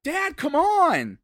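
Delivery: put-on pleading little kid; whiny kid. Kid scolding his dad about the ball, high whiny child. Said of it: "it's like".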